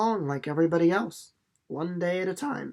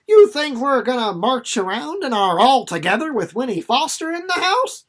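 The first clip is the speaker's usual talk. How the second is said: teasing; 6 semitones higher, 8 dB louder than usual. Acted. Teen son teasing his mother.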